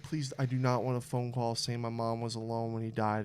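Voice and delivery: low, soft plea; retold prayer. Owner dreading a phone call about the dog, quietly pleading. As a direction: pray it quietly, pitch dropped. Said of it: "I'm praying".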